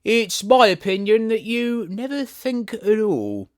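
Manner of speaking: high, loud, slow put-down; severe. A narrator's reading. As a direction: scold the girl coldly. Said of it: "in a rather severe tone".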